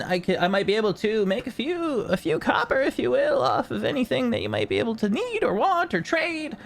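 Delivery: high, genial merchant voice; smooth, eager haggling; jolly tinkerer. Acted. Tinkerer offering trades to the party, high and genial. Tinkerer resumes sales pitch.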